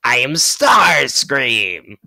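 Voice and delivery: loud, slow villain announcement; Starscream. Acted. Starscream announces himself, loud villain cry. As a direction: proclaim the name at full voice, stretched. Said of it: "Starscream, Soundwave, and Shockwave".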